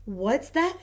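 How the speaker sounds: put-on high, wondering child voice; curious little pig. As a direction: ask it like an eager little girl. Peppa curious.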